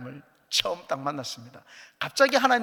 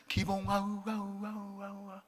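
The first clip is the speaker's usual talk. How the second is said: a call that echoes away softer and softer; God's echoing voice; 11 dB quieter than usual. Pretend. God calling the pastor by name, echo fading to nothing. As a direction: let each 우와 fade out like an echo in a hall. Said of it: "다시 한번 하겠습니다".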